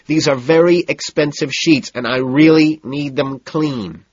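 high and loud, overriding her. A narrator's reading. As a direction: push the words over her babble. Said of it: "and then louder, still over her".

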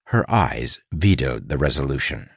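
plain reading voice

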